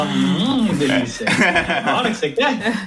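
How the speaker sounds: high, laughing mock flirtation; flirty baker. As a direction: purr it teasingly like a cheeky baker. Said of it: "O padre fala".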